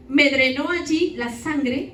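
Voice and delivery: normal talking voice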